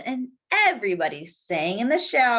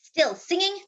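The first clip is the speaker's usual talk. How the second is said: high snapping question; snapped; 8 semitones higher than usual. Acted. Mayor to rooster, a higher snap. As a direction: snap it in disbelief. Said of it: "snapped Don Pepe".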